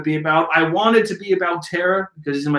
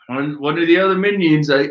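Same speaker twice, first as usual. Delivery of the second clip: ordinary talk, nothing marked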